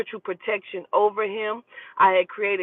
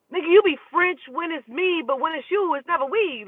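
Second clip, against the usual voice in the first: incredulous; 8 semitones higher than usual. Real, not acted. Mocking his use of we.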